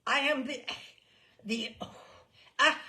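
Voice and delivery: stuck, halting stammer. A narrator's reading. Umpire tries to name himself, stammering. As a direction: trip over the words.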